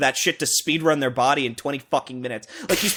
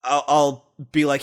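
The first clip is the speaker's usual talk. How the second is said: usual speaking voice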